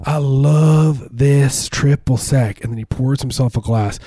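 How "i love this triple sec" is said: put-on southern accent.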